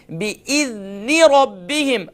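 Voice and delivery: slow tajweed chant; Quran recitation. Shown as a demonstration. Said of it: "ہم پڑھتے ہیں". (قرآن کی تلاوت)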